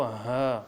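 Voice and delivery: ہا low and heavy; thick throat sound. Shown as a demonstration. Teacher modelling the letter, low thick ہا. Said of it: "حلق سے موٹی آواز نکالنی ہے".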